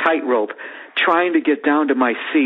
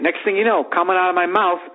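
normal talking voice

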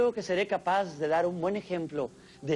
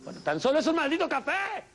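high exasperated outburst; mock fed-up rant in a high voice; losing patience; 9 semitones higher than usual. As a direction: crack from waiting for coffee. Snapping over late coffee.

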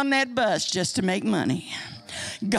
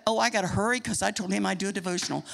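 rushed, lower self-interruption; hurried aside; 5 semitones lower, 3 dB quieter, much faster than usual. Real, not acted. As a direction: rattle it off, short on time. Hurrying herself through the devotional.

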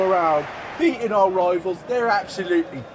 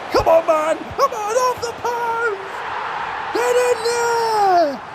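ecstatic shout. Real, not acted. Fan seeing his striker score, ecstatic roar. Goal in the final.

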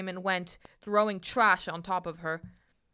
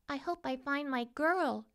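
higher, understated thinking voice; princess thinking to herself; 6 semitones higher, 4 dB quieter than usual. A narrator's reading. Princess hopes to find her girl, higher thinking voice. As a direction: lightly voice a hopeful private thought. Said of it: "she thought".

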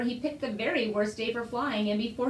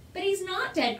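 put-on higher-pitched boy's protest; crying out; 7 semitones higher than usual. A narrator's reading. Boy telling parents the dragon lives, crying out. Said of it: "cried the boy".

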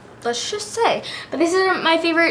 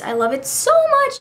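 gushing; 12 semitones higher than usual. Real, not acted. Gushing over favorite nail polish.

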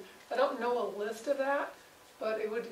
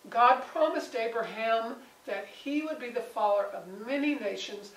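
ordinary talk, nothing marked

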